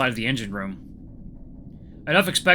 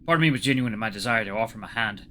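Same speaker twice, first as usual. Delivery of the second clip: ordinary delivery, nothing marked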